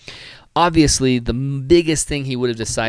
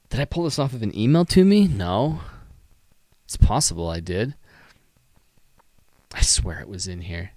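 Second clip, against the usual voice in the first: normal talking voice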